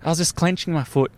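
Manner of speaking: usual speaking voice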